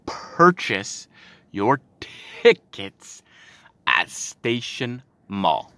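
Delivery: word by word, with pauses between; "Purchase ... your ... tickets ... at Station Mall", each chunk set apart; slow, pointed emphasis. Shown as a demonstration. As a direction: spell it out slowly for an idiot. Repeats the flyer words pointedly.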